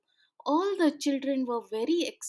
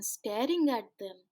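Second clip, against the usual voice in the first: plain reading voice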